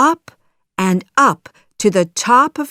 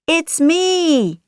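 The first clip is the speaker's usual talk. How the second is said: high, drawn-out call; 10 semitones higher, 4 dB louder than usual. A narrator's reading. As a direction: call to be recognised. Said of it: "shouts Pinocchio".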